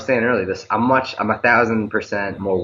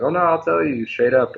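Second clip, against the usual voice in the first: candid local complaint; 9 semitones higher than usual. Retold or imitated. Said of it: "if they're bad, they're like".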